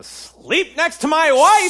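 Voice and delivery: loud, high, slow outburst; husband shouting so the whole camp hears. Acted. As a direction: yell it out in frustration. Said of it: "Out here screaming about fornicating?".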